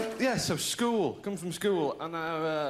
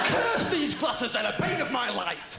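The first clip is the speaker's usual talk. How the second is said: mock melodrama; 8 semitones higher, 4 dB louder, slower than usual.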